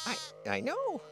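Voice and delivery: high, soft cooing. Real, not acted. Farmer soothing a baby calf, high soft coo.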